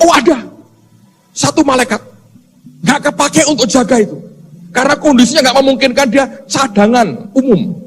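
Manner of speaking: owning up reluctantly; sheepish Gabriel. Retold or imitated. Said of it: "Gabriel berkata".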